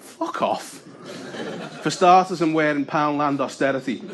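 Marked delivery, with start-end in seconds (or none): 0.0-0.8 breathy muttered brush-off; quietly telling a mate to get lost; whispered retort. Retold or imitated. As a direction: mutter it under your breath. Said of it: "And I was like".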